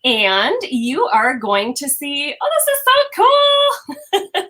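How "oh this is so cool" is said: excited. Real, not acted. Delighted at her screenshot.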